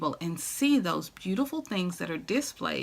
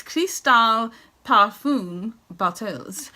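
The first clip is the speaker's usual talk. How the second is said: slow, louder mock French; little French accent; 2 semitones higher, 6 dB louder, much slower than usual. Vlogger presenting crystal bottles in slow, hammed-up French. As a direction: draw it out in a playful French accent. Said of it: "that's my little French".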